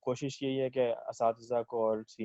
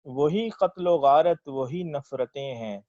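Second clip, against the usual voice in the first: slow, measured verse delivery; ghazal recitation; 4 semitones higher, 6 dB louder, slower than usual. Acted. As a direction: recite it like a poet at a mushaira. Recites own ghazal at mushaira.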